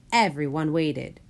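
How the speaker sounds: ordinary delivery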